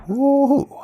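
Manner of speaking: high, loud joyful woo-hoo; excited whoop. A narrator's reading. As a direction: whoop with delight as you zip off. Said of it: "sent off proclaiming".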